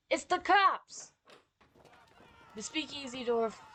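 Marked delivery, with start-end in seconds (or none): 0.1-1.1 loud, high, slow panicked cry; alarmed shout. Acted. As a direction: warn everyone the cops are here. Police bursting in.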